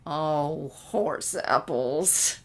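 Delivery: slow, drawn-out groan of dismay. A narrator's reading. Sunset groaning at herself over her plan, slow and dismayed. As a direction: moan it into the desk. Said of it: "she groaned".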